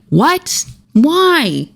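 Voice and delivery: high, stunned "What? Why?"; voiced Kate outraged; shocked disbelief.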